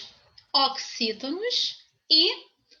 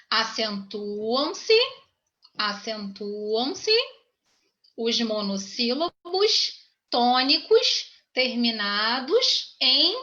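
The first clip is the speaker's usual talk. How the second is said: very slow dictation with rising ends; teacher dictating slowly, leaving each phrase hanging; slower than usual. Real, not acted. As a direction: stretch each word so they can write it. Teacher dictating notes to students.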